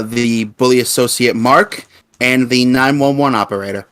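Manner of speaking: ordinary talk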